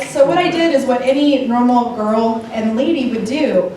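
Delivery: ordinary delivery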